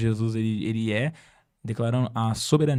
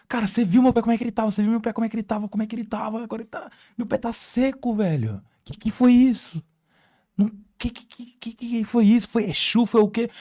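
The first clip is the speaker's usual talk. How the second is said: much higher, fast, astonished questioning; stunned disbelief; 10 semitones higher, 4 dB louder than usual. Retold or imitated. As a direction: fire off the questions, unable to believe it. Man stunned by his healing.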